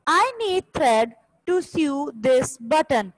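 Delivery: very slow, each word set apart; slow dictation. Shown as a demonstration. Teacher dictating example sentences to the class, word by word. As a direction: read it out so students can write along. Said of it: "First two lines is for the first sentence".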